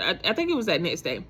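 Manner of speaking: usual speaking voice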